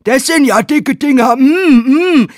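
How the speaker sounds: loud hammy leer; leering workman. Acted. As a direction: play the porn stud.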